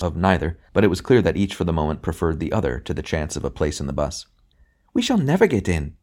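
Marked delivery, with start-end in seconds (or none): 4.9-6.0 high, whiny complaint; a woman moaning in the queue; whining woman. A narrator's reading. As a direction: whine it out. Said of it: "a female voice with a whine in it".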